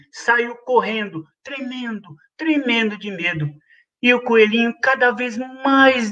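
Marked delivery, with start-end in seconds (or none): none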